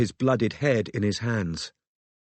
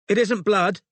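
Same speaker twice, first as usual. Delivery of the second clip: high, raised call; 9 semitones higher, 3 dB louder than usual. A narrator's reading. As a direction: call out from behind a curtain. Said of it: "Robin called".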